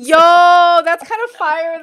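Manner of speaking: loud, high burst of approval; delighted reaction. Real, not acted. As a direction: whoop it out, bowled over by the joke. Delighted reaction to guest's joke.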